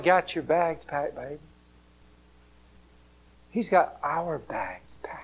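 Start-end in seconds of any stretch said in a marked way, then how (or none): 3.5-5.2 whisper. Real, not acted. Tender aside.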